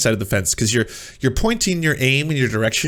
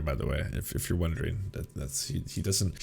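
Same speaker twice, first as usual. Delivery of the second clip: dropped much softer and lower, half under breath; a quiet lower side comment, nearly unvoiced; hushed aside; 8 semitones lower, 14 dB quieter than usual. Real, not acted. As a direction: lean in and murmur it low. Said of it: "aside about the streamer".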